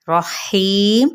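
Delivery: slow drawn-out Rahim with a heavy र; recitation. Acted. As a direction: chant Rahim slowly. Recitation demo.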